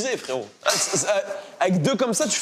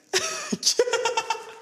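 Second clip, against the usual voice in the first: laugh. Real, not acted. Rire après l'espion.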